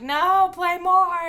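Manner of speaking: whiny crowd protest. Retold or imitated. Fans protesting that the show is ending, slow loud whine. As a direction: stretch it out like a begging crowd. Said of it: "And we're like".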